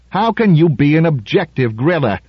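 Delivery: high-pitched challenge; demanding. A narrator's reading. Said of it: "demanded Rubin".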